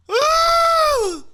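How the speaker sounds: piercing, very high, very loud scream; a blood-curdling scream over the screeching train. Retold or imitated. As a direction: scream to frighten the commuters. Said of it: "let out a blood-curdling scream".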